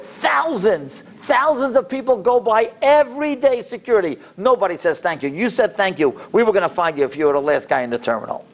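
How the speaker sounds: high, emphatic guard; a guard amazed at being thanked; security guard voice. Retold or imitated. Guard's emphatic praise, high and far.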